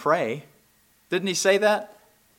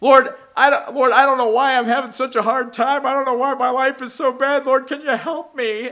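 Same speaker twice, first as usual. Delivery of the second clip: high, pleading voice of a discontented believer; a mocking imitation of a self-pitying prayer; whiny complaining prayer; 8 semitones higher, 4 dB louder than usual. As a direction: whine it to God like nothing goes right. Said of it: "people are saying".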